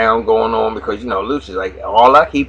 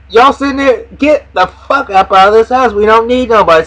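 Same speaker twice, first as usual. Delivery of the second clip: higher, louder shout to clear the room; Lucius yelling; 9 semitones higher, 4 dB louder than usual. Retold or imitated. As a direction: throw everyone out at full voice. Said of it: "he said".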